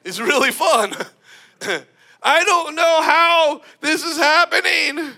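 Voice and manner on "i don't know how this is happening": amazed disbelief. Retold or imitated. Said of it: "you're like".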